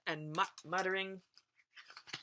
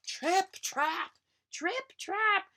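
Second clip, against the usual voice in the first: loud, high hoofbeat chant; big goat's hooves on the bridge; heavy hoofbeats; 12 semitones higher, 5 dB louder, much slower than usual. A narrator's reading. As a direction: stamp out each trip and trap. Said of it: "sound of ... huge hooves".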